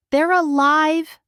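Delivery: hammed-up frightened scream. A narrator's reading. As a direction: shriek it out in terror. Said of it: "I scream".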